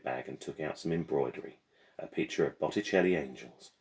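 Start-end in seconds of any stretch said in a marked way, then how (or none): none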